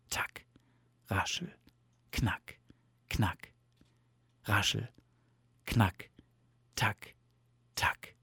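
soft, spaced-out little sounds; lulling sound words. A narrator's reading. Winter sounds lull Bear, lulling and soft. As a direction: quietly tick off each winter noise.

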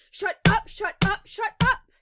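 frantic high screaming, building in fury; enraged, pounding shouts; growled. A narrator's reading. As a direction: yell it repeatedly at full force. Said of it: "he growls, banging his hands on the table".

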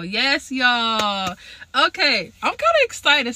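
can't hold the excitement in; excited. Real, not acted. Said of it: "please excuse my excitement".

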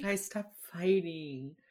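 drawn-out, softly whiny mock plea; pleading mother. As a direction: imitate a mom begging, stretching each word. Retold mother pleading with fighting children.

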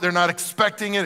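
ordinary talk, nothing marked

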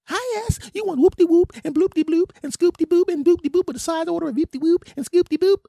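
loud, high falsetto patter; restaurant order taker. Acted. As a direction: chirp the order in squeaky falsetto.

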